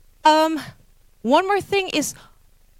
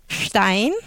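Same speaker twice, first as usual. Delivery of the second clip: "stein" without a fall, ending raised; flat tone; 2 semitones lower than usual. Shown as a demonstration. Lecturer showing one stone, "stein" in non-falling tone. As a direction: keep "stein" up to its end. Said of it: "Difference between flats and falling tone".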